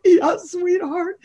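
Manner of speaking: put-on high, slow, tender reply to a child; high slow sing-song to daughter; sweet mom voice. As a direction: coo to a little girl. Mother answering little daughter.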